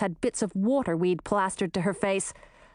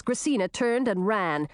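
usual speaking voice

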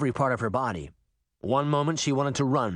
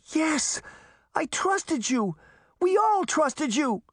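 high, slow, wounded accusation; hurt outburst, words drawn out; anguished cry; slower than usual. A narrator's reading. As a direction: cry out in betrayal, pitched way up. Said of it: "Sabrina cried".

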